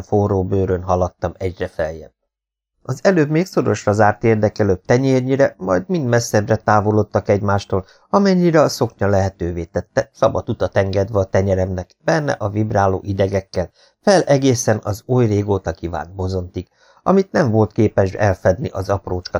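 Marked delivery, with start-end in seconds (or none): none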